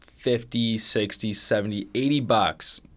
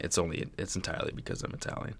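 whispered aside; 4 semitones lower, 10 dB quieter, faster than usual. Real, not acted.

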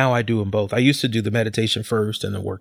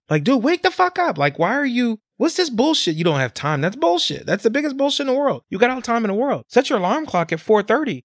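much higher, fired-up rant at excuses; heated rant; 10 semitones higher than usual. Real, not acted. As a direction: push it up high, exasperated and insistent. Ranting at people without time.

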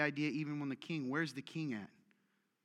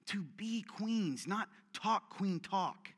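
slow emphatic build; much slower than usual. Acted.

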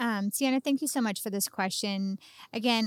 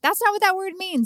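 higher, louder indignant comeback; indignant retort; 10 semitones higher, 8 dB louder than usual. Retold or imitated. Wife retelling her protest at her husband's word choice, indignant. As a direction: push back in a raised, protesting voice. Retold exasperated reply to spouse.

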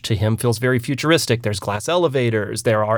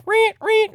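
very high squeaky reet reet; playful high-pitched squeak; high mock reet sound. Mocking a sound in retelling.

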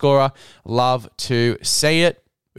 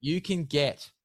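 sponsor ad read; 7 dB quieter than usual. Acted. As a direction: hype the product like a radio ad.

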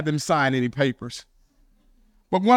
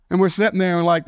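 ordinary delivery

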